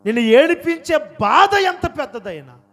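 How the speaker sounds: high, loud preacher's cry; full-force preaching. Real, not acted. Preacher to those carrying great pain, full-force preaching. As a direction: swell into an impassioned shout. Preacher urging the weeping congregation.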